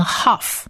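"huff" starting with a breathy h sound; "huff" as the undotted Hebrew letter, h-initial; undotted letter pronunciation. Shown as a demonstration. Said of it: "当它没有黑点的时候".

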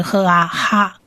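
赫 and 啊 sounded apart, then joined as 哈; blended Hebrew syllable. Shown as a demonstration. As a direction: build 哈 from an h sound plus a short a in 啊. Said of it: "它发音发赫的音". (示范拼读：赫、啊，合成哈)

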